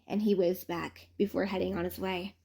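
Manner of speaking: plain reading voice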